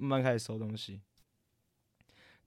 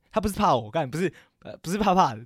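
emphatic self-correction; 5 semitones higher, 6 dB louder than usual. Real, not acted. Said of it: "我更正".

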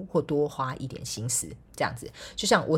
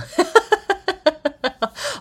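high laugh; 13 semitones higher, 7 dB louder than usual. Real, not acted. Laughs about milk tea.

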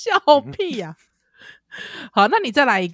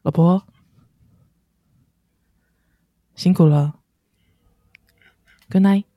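low, lightly voiced male line; calm husband goodnight; 5 semitones lower than usual. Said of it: "你先来个男性版的".

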